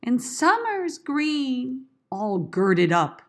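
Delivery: loud, slow, grand recital; big drawn-out Shakespearean declamation; declaimed verse. Shown as a demonstration. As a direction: project it slowly like a stage line. Rehearsing Shakespeare verse aloud.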